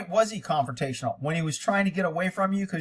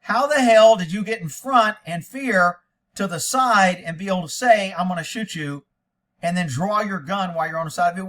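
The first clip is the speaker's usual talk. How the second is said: outraged rant; 4 semitones higher, 7 dB louder than usual. Real, not acted. Host ranting at the cop's story, outraged and loud. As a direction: hammer each word in disbelief.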